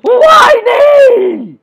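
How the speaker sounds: furious high yell, drawn out; Tuffy. A narrator's reading. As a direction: scream the name at the top of your voice. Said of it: "yelled Tuffy".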